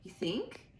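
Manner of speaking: ordinary delivery, nothing marked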